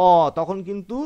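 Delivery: ordinary talk, nothing marked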